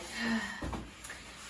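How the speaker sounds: soft unvoiced sigh; breathy sigh before speaking; wistful sigh. A narrator's reading. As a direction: let out a wishful sigh. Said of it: "Missus Moose sighed".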